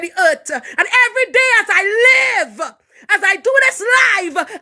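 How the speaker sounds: crying out, half laughing, fervent; tearful preaching shout. Real, not acted. Tearful preaching shout about her destiny.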